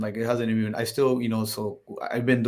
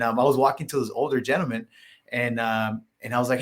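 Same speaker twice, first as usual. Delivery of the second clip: ordinary talk, nothing marked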